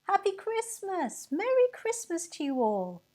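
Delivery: high, loud cheerful shout; crowd shouting back. A narrator's reading. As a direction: fling it back gaily. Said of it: "the crowds only shouted back".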